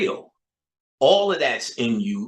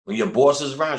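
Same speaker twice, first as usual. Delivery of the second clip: ordinary talk, nothing marked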